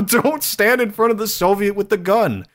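laughing while you scold; amused high laughing delivery. Real, not acted. Laughing through the advice.

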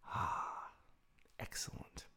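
soft unvoiced praise; whisper. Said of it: "whispered praise after breathing".

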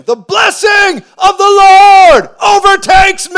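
very high, drawn-out full-force shout; jubilant shouting. Acted. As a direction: bellow it in triumph, stretching the last word. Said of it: "I started shouting".

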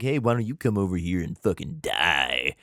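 taunting. Retold or imitated. Narrator voices God daring the Philistines to come over, taunting. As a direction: goad them with a low-voiced challenge. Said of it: "basically is taunting them".

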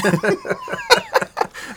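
high burst of laughter; laughing at own joke. Real, not acted. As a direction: laugh at your own punchline. Laughing at own joke's punchline.

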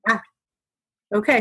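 usual speaking voice

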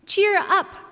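high, bright call to rally; cheery cry. A narrator's reading. Clown rallying the sad toys, high cheery cry. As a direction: cry it out high to lift their spirits. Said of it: "cried the little clown".

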